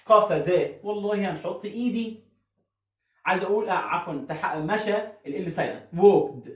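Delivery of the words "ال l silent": normal talking voice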